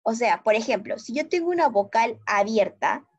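normal talking voice

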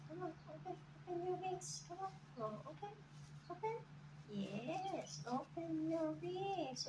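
soft, high sing-song coaxing; coaxing pet talk. Real, not acted. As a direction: coax the bird gently to open its wings. Coaxing bird to open wings.

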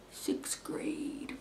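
hushed reveal; whispered reveal. Real, not acted. Dramatic reveal of school year.

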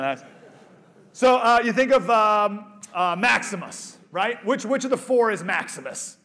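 ordinary delivery

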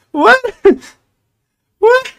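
very high, sharp what; shocked. Real, not acted. Streamer yelps at his vanished avatar, very high. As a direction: yelp it in disbelief.

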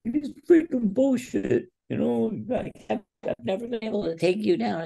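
put-on high, strained voice of a frustrated, near-tearful young wrestler; tearful frustration. Wrestler near tears complaining to his coach, high and strained. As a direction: squeeze it up high like you're about to cry. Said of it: "I have, like, tears in my eyes".

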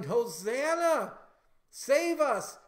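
high, loud, drawn-out acclaiming shout; crowd shouting. Acted. People hailing Jesus as king, shouting high and loud. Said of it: "and they're shouting".

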